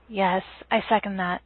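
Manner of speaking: usual speaking voice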